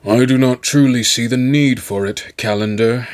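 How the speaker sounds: a mildly annoyed chancellor confiding his doubts; irritable. A narrator's reading. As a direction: grumble it privately to a trusted aide. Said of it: "confided irritably".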